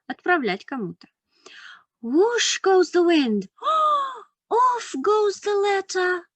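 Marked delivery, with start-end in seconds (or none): 2.0-3.5 put-on reading to a toddler. Reads picture book to children. 3.5-4.2 high sharp gasp of surprise; surprised gasp. Acted. Surprise at the flying letter.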